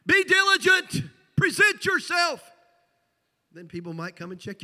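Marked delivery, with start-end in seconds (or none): 0.0-2.5 Be diligent shouted high, loud and slow; mock scream. Shown as a demonstration. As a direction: bellow it out slowly like a street preacher. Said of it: "I'm not saying you gotta scream it out".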